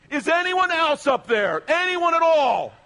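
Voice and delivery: high, loud, drawn-out yell; desperate shout. Retold or imitated. Man on the cliff shouting for someone else, slow, high and loud. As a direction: slowly holler up for anyone else.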